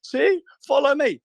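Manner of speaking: high, slow mock influencer, hammed up; high mock scam influencer voice. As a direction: play a scammy promoter luring followers. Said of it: "or my scam influencer voice".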